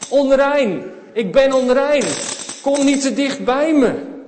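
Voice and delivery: high, loud calling out; leper's warning cry. Acted. Leper calling to passers-by to keep away, loud warning cry.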